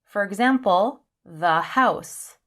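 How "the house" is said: THE as "thuh" before house; short THE, H sounded in house. Shown as a demonstration. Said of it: "pay attention to the sound".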